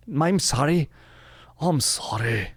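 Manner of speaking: put-on Scottish apology; Scottish accent. Shown as a demonstration. Host apologizing to listeners for jumping ahead, in mock Scottish accent. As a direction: apologize with a comic Scottish lilt. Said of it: "say it in a Scottish accent".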